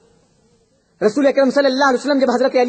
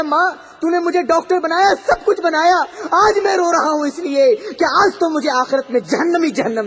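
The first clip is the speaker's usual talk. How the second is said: raised, pleading voice of a dying son; dying son crying out; 6 semitones higher than usual. Acted. A dying son pleads to his mother, raised and lamenting. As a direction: cry out the regret, pitch high.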